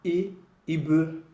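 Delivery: Ibe said clearly, restarted after a false start on I; Woloduka word for hand. Shown as a demonstration. Teacher pronounces Ibe, the word for hand, for students. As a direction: model Ibe carefully for learners. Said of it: "La main, c'est".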